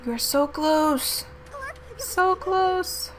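will it over the line; eager urging. Real, not acted. Urging the game on.

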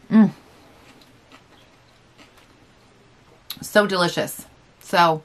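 talking between bites of food; muffled speech with food in mouth; mouth full, chewing. Real, not acted. Seen 3: Bites at -1.0; cheeks full, chewing while murmuring and talking until ~5.3.

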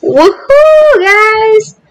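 high, elated cheer to viewers; excited whoop. Real, not acted. Gamer telling viewers the war might end, excited whoop. Celebrates a game event.

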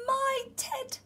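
high cry at normal volume; small, high voice of a child spotting a lost toy; amazed exclamation. A narrator's reading. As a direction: exclaim it high, without shouting. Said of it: "said Eddie".

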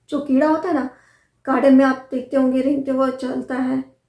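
usual speaking voice

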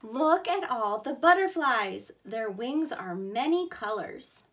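loud, clear storybook read-aloud; storybook voice for kids; reading to children. Acted. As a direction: read it big for little listeners.

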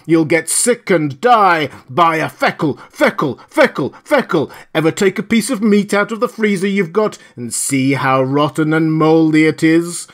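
loud, high, hammering repeated cries; screamed sales pitch. A narrator's reading. Loudspeakers erupting to catch him off guard, screaming. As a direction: scream the slogan and threats relentlessly. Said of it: "It screamed".

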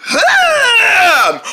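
long, high straining groan; lifting grunt. Pretend. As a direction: heave with a drawn-out groan. Group heaves a third time.

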